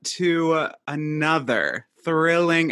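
high, loud, drawn-out hello sliding into a slow welcome; showy host welcome. Pretend. Host greeting listeners to a new episode, showy and loud. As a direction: belt out a grand podcast opening.

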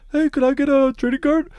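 high-pitched voice of a guy asking a favor; eager passenger. Retold or imitated. Retelling a viral video of a pilot, high passenger voice. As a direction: put on a high, hopeful asking voice. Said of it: "this is guy, like, being like".

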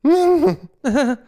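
high, loud laughing fit; loud shrieking laugh; burst of laughter. Real, not acted. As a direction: crack up at the memory. Retelling the girl's odd laugh.

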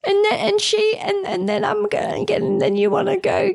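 put-on high, breathless little kid voice; excited kid rambling. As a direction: babble like an excited small child. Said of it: "That's how little kids tell stories".

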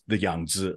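"Yangtze" as Yahng-dzuh, second syllable low; native Chinese pronunciation. Shown as a demonstration. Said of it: "is how you would say it".

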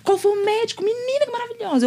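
lightly voiced salesgirls fawning; gushing shop girls. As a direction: rave like an impressed shopgirl. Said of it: "as meninas ... ficou".

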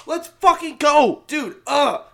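high, loud, stretched-out cheer; hype yell. Real, not acted. Host cheering the new football season, pumped yell.

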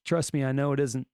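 ordinary talk, nothing marked

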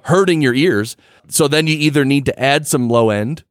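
ordinary delivery

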